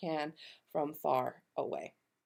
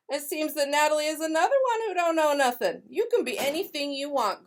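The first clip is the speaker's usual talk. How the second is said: higher, louder scornful granny; indignant grandmother; 8 semitones higher, 6 dB louder than usual. Acted. Nana defending Grace against Natalie, indignant granny. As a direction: brush off the insult, then encourage.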